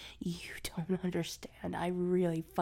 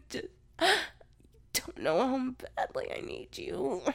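ordinary talk, nothing marked

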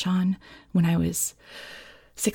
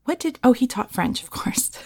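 ordinary talk, nothing marked

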